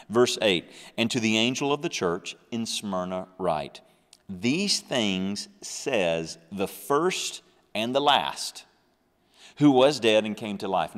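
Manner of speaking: loud, measured scripture read. Acted. Preacher reading verse to congregation, loud and measured.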